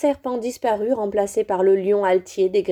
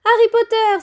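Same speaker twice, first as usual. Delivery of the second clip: put-on high, loud girlish cry; excited cry; 13 semitones higher, 5 dB louder than usual. A narrator's reading. Ginny crying out on seeing Harry, high and loud. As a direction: shriek the name in delight. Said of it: "s'écria-t-elle".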